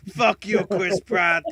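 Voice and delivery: put-on loud, high Italian accent insult. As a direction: shout it in a Mario-style Italian accent. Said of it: "I do the Italian accent".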